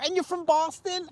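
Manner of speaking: high-pitched amazed question; mock-thrilled common ground; delighted discovery. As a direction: squeal it as a happy realization. Acted friendly discovery with driver.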